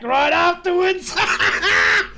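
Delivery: high, loud laughing yelp; laughing shout. Real, not acted. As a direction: burst out laughing mid-word. Laughing outburst at chat joke.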